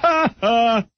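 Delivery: name sung up high; playful high-pitched name call; mock high greeting. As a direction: squeal the name in a falsetto.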